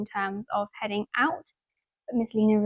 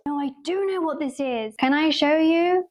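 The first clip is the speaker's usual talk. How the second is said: ordinary delivery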